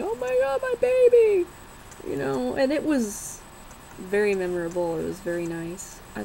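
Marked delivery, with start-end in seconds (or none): 0.0-1.5 high, wailing mock sob of a mother; imitating crying mom. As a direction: wail it like a tearful mom. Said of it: "she just started crying and crying".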